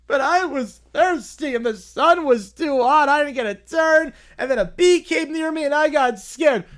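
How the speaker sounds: high, loud pleading whine; whiny little boy. Acted. Little boy pleading excuses to the boss, high whine. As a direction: whimper out the excuses.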